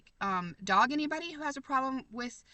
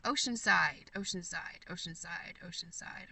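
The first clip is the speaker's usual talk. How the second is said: repeated, getting much softer each time; fading repetition; 5 semitones lower, 5 dB quieter than usual. Real, not acted. Trying to remember the place, repeating it fading. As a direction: mull the name over until it fades out. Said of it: "I'm trying to remember".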